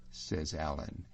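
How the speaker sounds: ordinary delivery, nothing marked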